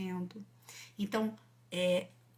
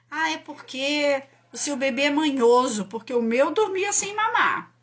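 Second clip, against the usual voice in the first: loud, high, smug know-it-all mother; 4 semitones higher, 8 dB louder than usual. Retold or imitated. Judging mother tells another her baby is spoiled, smug and loud. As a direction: lord it over the other mom, louder and higher.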